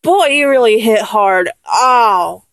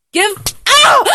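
sharp pained cry, much higher; sudden ow; 9 semitones higher than usual. Acted. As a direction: yelp as the slap lands. Slapped by partner.

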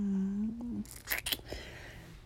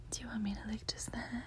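whispering; 12 dB quieter than usual. Acted. Whispers throughout, intimate.

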